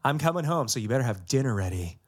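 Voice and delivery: ordinary talk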